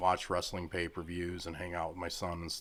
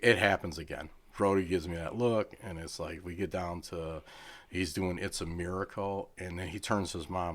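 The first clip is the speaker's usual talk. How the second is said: ordinary talk, nothing marked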